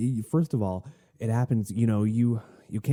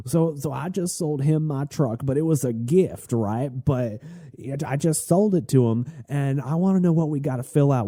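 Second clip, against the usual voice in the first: higher, louder Southern gentleman imitation; thick Southern accent; 6 semitones higher, 3 dB louder than usual. Southern gentleman explaining his truck deal to the clerk, in a mock Southern accent. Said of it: "with a very thick Southern accent".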